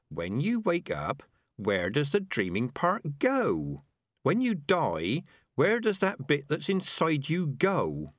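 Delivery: slow, high rat puzzling it out; questioning rat. Acted. Rat pressing the others about the invisible part, pondering. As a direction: spell out the big question slowly.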